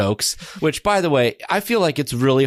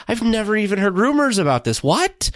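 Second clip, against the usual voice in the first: incredulous; 10 semitones higher than usual. Retold or imitated. Disbelieving retelling of own reaction.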